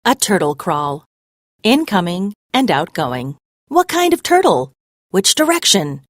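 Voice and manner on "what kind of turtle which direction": higher, louder pressing questions; demanding. A narrator's reading. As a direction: fire the questions off, insisting on answers. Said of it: "Graham demands".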